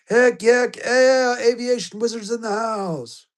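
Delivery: high, loud heck yeah; hyped cheer. Real, not acted. Streamer cheering a viewer's range day, high and loud. Streamer hypes a chat message.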